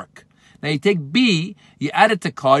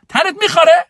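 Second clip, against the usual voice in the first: sharp high threat, louder; mock threat; 13 semitones higher, 7 dB louder than usual. Answering someone stupid on social media, sharp high threat. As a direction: fire it back like a snippy comeback. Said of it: "someone says something stupid".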